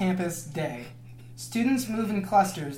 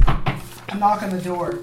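usual speaking voice

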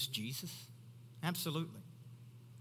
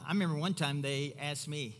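ordinary talk, nothing marked

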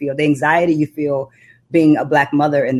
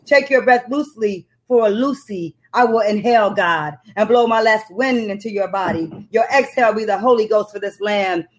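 spoken word poem; 7 semitones higher than usual. Acted. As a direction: recite it like a slam poet, every line weighted. Performing her spoken word poem.

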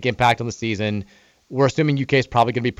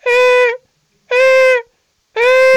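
high loud mechanical beeps; forklift beeping; 7 dB louder, much slower than usual. Retold or imitated. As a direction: imitate a forklift's reverse beep. Imitating forklift backup beeping.

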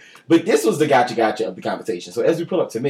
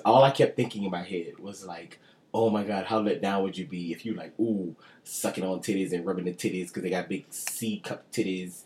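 hushed gossip; 6 semitones lower, 9 dB quieter than usual. Retold or imitated. Hushed raunchy retelling.

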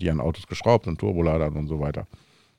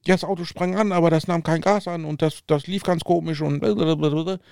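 higher, flustered daughter; daughter's voice; 8 semitones higher than usual. Retold or imitated. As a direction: mimic the daughter explaining the car. Tochter schildert Autoproblem. (Tochter nachgeahmt, höher)

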